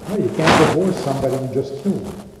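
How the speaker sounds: ordinary talk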